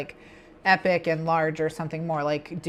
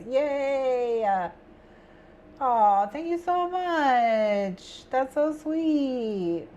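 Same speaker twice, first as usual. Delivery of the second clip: touched; 6 semitones higher, much slower than usual. Real, not acted. Thanking a viewer in chat.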